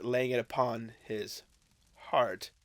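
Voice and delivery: usual speaking voice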